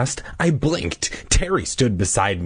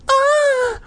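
high scream; 4 dB louder than usual. Acted. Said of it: "I let out a low cry".

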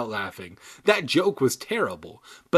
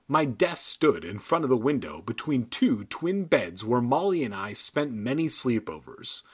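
ordinary delivery

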